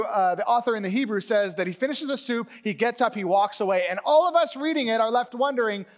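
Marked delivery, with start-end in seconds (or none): none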